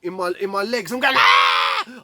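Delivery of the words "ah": loud scream of pain; full-voiced acted scream; re-enacted scream. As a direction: scream out as if being hurt. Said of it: "I'm screaming".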